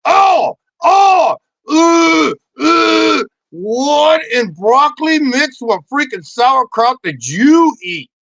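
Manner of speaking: high, loud, drawn-out revulsion; hammed-up outraged shout; mock disgust. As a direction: wail in exaggerated horror, slowly. Acted disgusted spouse, bad day.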